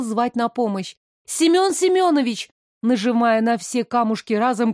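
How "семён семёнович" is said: high urgent call; shouting. Acted. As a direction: call out for help. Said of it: "кричала я".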